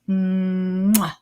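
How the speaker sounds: a leaning-in mwah; flirty air kiss; kiss sound. Shown as a demonstration. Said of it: "you kinda lean in with it".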